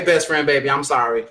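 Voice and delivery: normal talking voice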